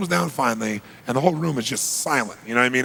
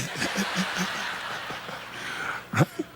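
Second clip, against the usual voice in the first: breathy laugh; 4 semitones higher, 8 dB quieter than usual. Real, not acted. Laugh after story beat.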